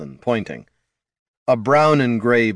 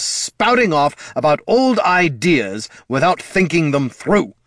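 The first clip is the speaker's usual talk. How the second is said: high, heated scolding; furious parental lecture, pitch held up; exasperated tirade; 6 semitones higher, 3 dB louder than usual. A narrator's reading. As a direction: rant on, hammering each grievance. Lich's yelled tirade continues at son.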